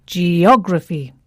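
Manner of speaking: geography, stress on the OG syllable, falling; correct stress on OG. Shown as a demonstration.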